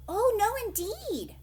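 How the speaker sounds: high, loud alarmed protest; alarmed cry. Acted. Old Pat denying he wants the gold, high alarmed cry. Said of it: "cried old Pat, mightily alarmed".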